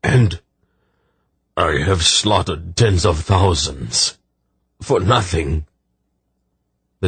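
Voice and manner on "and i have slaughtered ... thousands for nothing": slow, anguished confession; understated, halting grief; tearful groan. A narrator's reading. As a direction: drag the words out in grief. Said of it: "he groaned with tears".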